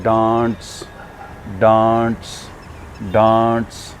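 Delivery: डांस drawn out as "daants"; slow separate model takes of डांस, long vowel; long aa sound. Shown as a demonstration. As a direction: hold the "aa" in डांस each time. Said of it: "next हमारा word है".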